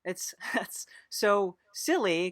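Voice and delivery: ordinary talk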